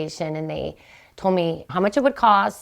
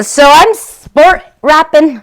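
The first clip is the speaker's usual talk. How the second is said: showing off; 9 semitones higher, 12 dB louder, slower than usual. Shown as a demonstration. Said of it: "Is that what they say?".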